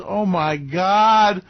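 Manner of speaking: usual speaking voice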